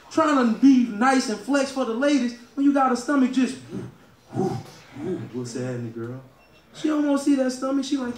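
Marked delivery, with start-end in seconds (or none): 4.3-6.2 put-on low, slow, soft come-on to a girl; slow lazy pickup line.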